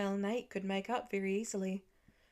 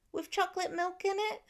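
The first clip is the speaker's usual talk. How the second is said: put-on small boy voice; 10 semitones higher than usual. A narrator's reading. Hopeful small boy asks.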